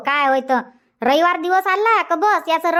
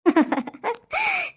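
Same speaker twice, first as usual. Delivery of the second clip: high soft chuckle; amused laugh; 10 semitones higher, 5 dB quieter than usual. Acted. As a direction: laugh gently at the child. Grandmother laughs at child.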